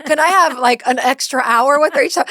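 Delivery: put-on pleading kid. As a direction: imitate an eager child, pitch leaping up on the good part. Retelling her son's eager plea.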